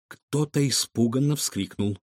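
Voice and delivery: ordinary delivery, nothing marked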